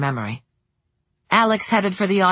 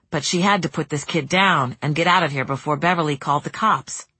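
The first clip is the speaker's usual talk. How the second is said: ordinary delivery, nothing marked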